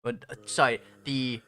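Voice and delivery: ordinary delivery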